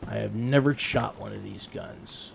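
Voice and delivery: normal talking voice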